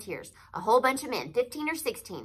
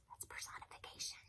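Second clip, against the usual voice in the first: quick hushed aside; whispered aside; 21 dB quieter than usual. Real, not acted. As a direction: drop to a whisper to explain. Teacher aside whispering figure names.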